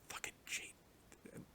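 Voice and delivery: slow, hissed curse under the breath; slow whispered grumble at Jaden; annoyed whisper. Real, not acted. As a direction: whisper it in disgust. Annoyed aside about a character.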